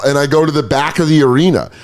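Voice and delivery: ordinary delivery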